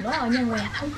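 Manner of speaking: very soft instruction on the side; hushed prompting. Real, not acted. Tells friend which place to name, hushed. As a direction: keep it under the phone conversation. Secretly prompting during the call.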